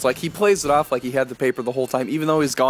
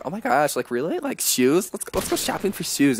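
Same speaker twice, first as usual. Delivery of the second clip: mock girly talk; 4 semitones higher than usual. Guys making fun of girls, mock girly voice. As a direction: put on a ditzy girl voice. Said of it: "talk like this".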